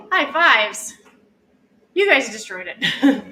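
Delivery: hoarse hyped-up workout cheer; hoarse marathon cheering. Acted. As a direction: drive them through the last seconds. Final push of the workout.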